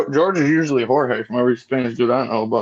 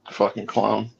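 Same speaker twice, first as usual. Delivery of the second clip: ordinary delivery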